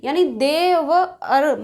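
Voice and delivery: देव and अर said slowly as separate parts; teacher splitting the word apart; split into parts. Shown as a demonstration. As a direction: break देवर्षि into देव अर, slowly. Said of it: "अर का जो उच्चारण है".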